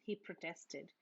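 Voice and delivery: ordinary delivery, nothing marked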